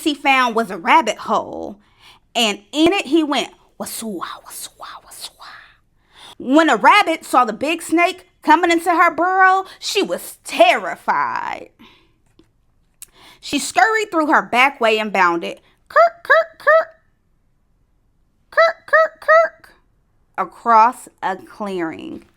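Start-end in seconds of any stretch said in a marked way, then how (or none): 3.8-5.5 whispered slither, fading away; soft swishing whisper that trails off; imitated slithering. A narrator's reading. Story sound of snake slithering in. 15.9-19.7 very high, louder squeaked hops; imitated rabbit hops. A narrator's reading. Story sound of rabbit bounding.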